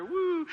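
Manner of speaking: high drawn-out "woo"; playful whoop. Retold or imitated. Dad dancing with his little kids, playful whoop. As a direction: whoop as if twirling a baby.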